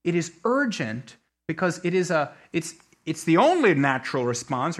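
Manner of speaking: usual speaking voice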